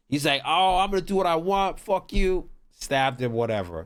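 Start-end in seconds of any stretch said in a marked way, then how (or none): 0.5-2.5 high, cocky comeback; defiant retort. Retold or imitated. A stranger mouths off at the robber, high and defiant. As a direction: throw it back at him defiantly.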